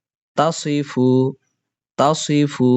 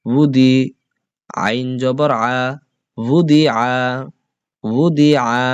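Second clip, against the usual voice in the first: teacher's chanted letter-by-letter recitation; spelling drill; slower than usual. Shown as a demonstration. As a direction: spell out each syllable for the class to repeat.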